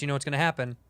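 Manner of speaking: ordinary talk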